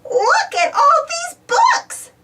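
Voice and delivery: excited shout. A narrator's reading. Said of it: "shouts Baby Bop".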